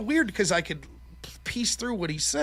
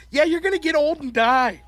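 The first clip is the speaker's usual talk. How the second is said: higher, louder, comically blunt delivery; mock parental answer; 7 semitones higher, 6 dB louder than usual. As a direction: break grim news to a child, hammed-up. Mock reassurance to crying son.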